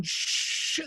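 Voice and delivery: long, drawn-out shush. Acted. Happy singers hushing each other in the sukkah, shushing. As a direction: hush your friend with a long shh. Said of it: "each one says to the other".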